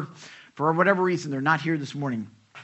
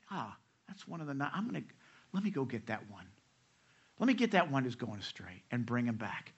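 tender shepherd; 4 semitones lower, 7 dB quieter, faster than usual. Retold or imitated. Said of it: "No, he's saying".

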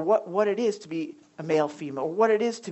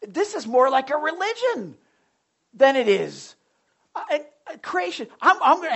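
higher, louder mock zealot; fired up; 7 semitones higher, 5 dB louder than usual. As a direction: imitate a worked-up man on his crusade. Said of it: "he was really fired up".

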